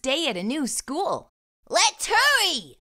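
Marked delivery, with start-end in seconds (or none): 1.7-2.8 high, loud, drawn-out exclamation; excited exclaiming. A narrator's reading. Exclamation point urging the family on to school, excited. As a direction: exclaim it big and eager. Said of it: "he exclaimed".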